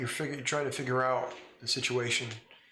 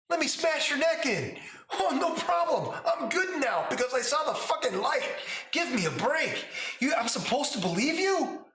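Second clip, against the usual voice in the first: very pissed off; 12 semitones higher than usual. Acted. Adult Lila berating the player over casualties, high furious yelling. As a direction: scream it in outraged disbelief. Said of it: "very pissed off".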